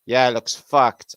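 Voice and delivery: emphatic slow repeat. Shown as a demonstration. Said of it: "I said that".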